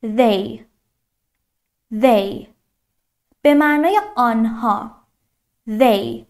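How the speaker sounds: They said slowly and clearly; teacher modelling They; careful They. Shown as a demonstration. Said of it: "تلفظش چطوریه؟".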